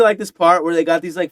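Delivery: ordinary talk, nothing marked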